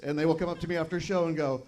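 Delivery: usual speaking voice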